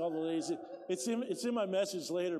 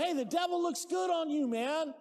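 mock friendly greeting; 9 semitones higher, 4 dB louder than usual. Mock greeting to heathen relative.

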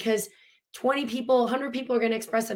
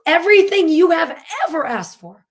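emphatic; 8 semitones higher, 9 dB louder than usual. Real, not acted. Emphatic peak of her point.

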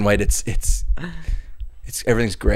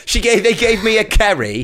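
higher-pitched mock British accent; 7 semitones higher than usual. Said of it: "gotten better at his accent".

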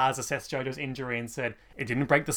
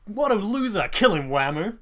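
jeering vampire voice; 7 semitones higher, 5 dB louder than usual. Retold or imitated.

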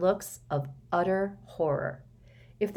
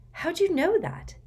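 astonished; 13 semitones higher, 3 dB louder than usual. Acted.

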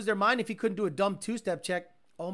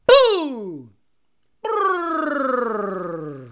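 engine blowing up; 4 semitones higher, 11 dB louder than usual. Retold or imitated.

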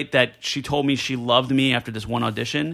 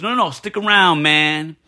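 seventies Puerto Rican; 2 semitones higher, 4 dB louder than usual. Retold or imitated. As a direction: imitate the director's seventies Nuyorican swagger, lightly. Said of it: "he thinks he's Puerto Rican from, like, the seventies".

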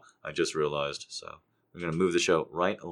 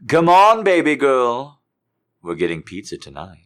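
higher, louder cheerful shout; peppy excited call; cheerful hype; 5 semitones higher, 12 dB louder, slower than usual. Acted. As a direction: pump up the crowd. Cheerful version.

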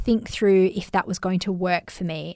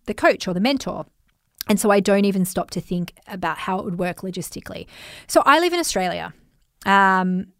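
usual speaking voice

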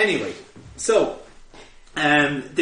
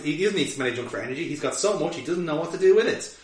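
ordinary talk, nothing marked

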